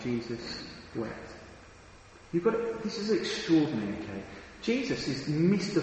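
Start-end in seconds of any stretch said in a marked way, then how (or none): 0.0-1.3 hushed reading. Real, not acted. Reads the key verse hushed.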